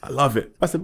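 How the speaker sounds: usual speaking voice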